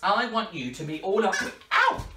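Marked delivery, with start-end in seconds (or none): 1.7-2.0 high yelp of mock pain; pretend pain. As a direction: yelp like your nose got pinched. Parrot bites nose again.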